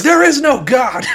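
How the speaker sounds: slow, high-pitched bellowed declaration; defiant shout. Retold or imitated. Kid kicking down the patrol shack door, defiant shout. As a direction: proclaim it in comic outrage, drawn out. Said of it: "I kicked that door down".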